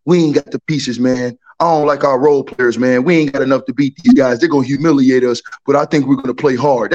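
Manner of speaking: deep, dragging imitation of a defeated fan; low mocking impression. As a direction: drop the voice way down and mope it out. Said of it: "he said, and I quote".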